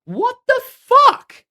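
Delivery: very high, loud, drawn-out shriek of disbelief; incredulous high-pitched outraged yell; outraged tiger. Acted. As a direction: protest the unfair punishment at full pitch. Tiger protests its punishment.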